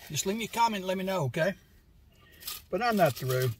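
chewing food while talking; talking between bites; mouth full. Real, not acted. Seen 2: Food wrap raised to mouth for a bite, then talks on.

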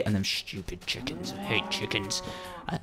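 low, very quiet grumbling aside; muttered grumble. Real, not acted. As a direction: mutter it slowly under your breath. Grumbling aside about chickens.

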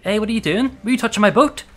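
loud, high yell at the intruder; angry yell. Real, not acted. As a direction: shout it off the boat. Yelling at a creature near boat.

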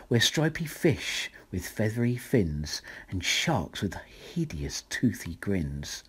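ordinary delivery, nothing marked

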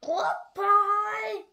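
high, loud frog goodbye; croaking frog. A narrator's reading. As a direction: call farewell in a frog voice.

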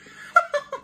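sudden high-pitched laugh; high burst of laughter. Real, not acted. Laughing at lost fake tooth.

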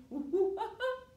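high hooting monkey laugh; a silly high monkey giggle. Pretend. Teacher to kids, playing the monkey, high giggle.

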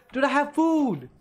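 very high, loud exasperated yell; frustrated yelling. Real, not acted. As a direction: scream at the cat that ignores the food. Yelling at the cat.

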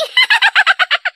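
squawky parrot cackle; a cheeky bird's cackling laugh. Acted. Parrot cackles after teasing George.

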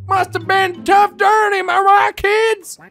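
put-on high, loud, slow folksy old-timer; old prospector. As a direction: play a hollering old gold miner. Folksy voice to frozen character.

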